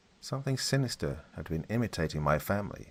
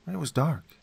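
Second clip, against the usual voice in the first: put-on higher, childlike boy voice; young boy voice; 9 semitones higher, 3 dB louder than usual. A narrator's reading. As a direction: speak as a small boy. Son speaks from the tree.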